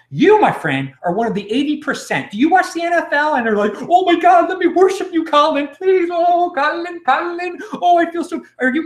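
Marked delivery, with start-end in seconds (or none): 3.8-8.4 high-pitched hammed-up fan worship; mock adoring fan. Host mocking NFL fans worshipping their idol, gushing high. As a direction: gush mockingly like a star-struck football fan.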